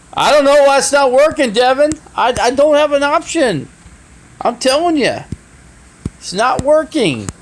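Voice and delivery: frustrated outburst. Real, not acted. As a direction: let the frustration burst out.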